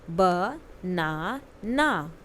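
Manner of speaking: "Banana" stretched, every syllable apart; very slow model word. Shown as a demonstration. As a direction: model it very slowly to copy. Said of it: "listen to your teacher and say the following word". (बहुत धीरे, हर अक्षर अलग "बनाना")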